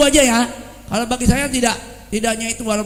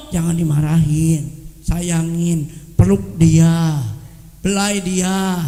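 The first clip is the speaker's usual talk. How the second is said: tender advice; 7 semitones lower, much slower than usual. Retold or imitated. Preacher models gentle advice.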